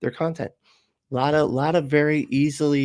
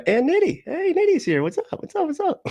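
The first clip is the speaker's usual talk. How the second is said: excited greeting. Real, not acted. Greeting viewer cheerfully.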